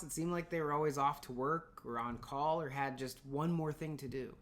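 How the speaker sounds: ordinary delivery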